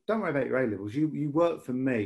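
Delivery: ordinary talk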